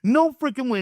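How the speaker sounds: imitated indignation, pitched very high; high, worked-up mock outrage; pissed off. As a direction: act out a fed-up man ranting in a high voice. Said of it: "he's getting pissed off".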